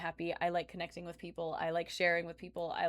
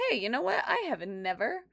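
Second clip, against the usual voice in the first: much higher, bright eager voice; excited; 11 semitones higher, 5 dB louder than usual. Retold or imitated. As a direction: squeal it like a giddy thought. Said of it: "I was like".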